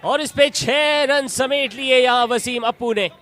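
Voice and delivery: high and loud, slowing on the finish; excited cricket commentary. Real, not acted. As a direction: shout the six, then savour it. Free hit six called live.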